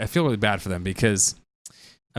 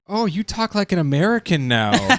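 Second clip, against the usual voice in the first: higher, slow, teasing remark; mock family; 8 semitones higher, slower than usual. Australian family teasing her lost accent. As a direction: tease her like relatives do. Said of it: "they're all like".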